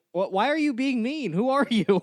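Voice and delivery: high-pitched, baffled mock indignation; indignant protest. Retelling his comeback to the yelling woman, indignant. Retold confrontation with heckling stranger.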